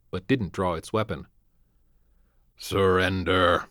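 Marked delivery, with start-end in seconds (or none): 2.6-3.7 slow, modulated machine voice with no intonation; inhuman robot voice. A narrator's reading. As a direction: speak like a cold menacing machine. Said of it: "voice was strangely modulated ... inhuman".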